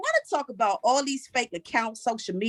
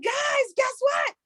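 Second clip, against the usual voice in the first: excited mom announcement; 10 semitones higher, slower than usual. Retold or imitated.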